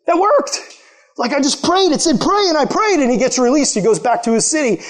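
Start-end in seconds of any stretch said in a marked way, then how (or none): 0.1-3.0 much higher, stunned wonder; amazed disbelief. Retold or imitated. Released prisoner amazed his prayer worked, much higher.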